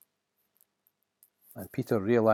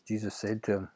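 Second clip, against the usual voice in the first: plain reading voice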